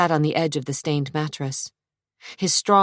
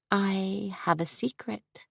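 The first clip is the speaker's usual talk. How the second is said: put-on soft, slow, secretive young woman; Trisha, hushed; 5 semitones higher, 8 dB quieter, slower than usual. A narrator's reading. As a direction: tease him with a secret, softly. Trisha's voice in story.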